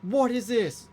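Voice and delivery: high, exasperated orc outburst; angry searcher yelling out in disbelief; cursing orcs. Acted. Game master voices orcs hunting the hidden party, angry. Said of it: "They're cursing and going".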